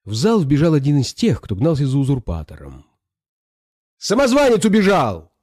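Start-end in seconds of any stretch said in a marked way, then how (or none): 4.0-5.3 high-pitched urgent report; raised, excited yell; alarmed shout. A narrator's reading. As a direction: cry out the news high to the hall. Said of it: "закричал он".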